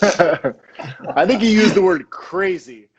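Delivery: real laughter breaking through the words; laughing. Real, not acted. [name] laughing at Seb's story of him showing up, real laughter. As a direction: talk while cracking up.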